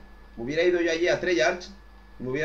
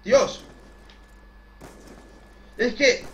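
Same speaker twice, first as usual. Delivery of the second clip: frustrated outburst; 8 semitones higher, 5 dB louder, much slower than usual. Real, not acted.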